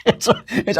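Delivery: high laughing mock hysterical voice; mock hysterics, laughing. Host reprises the hysterical pundit bit while laughing. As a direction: giggle through a shrill fake meltdown. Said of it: "I'm hysterical".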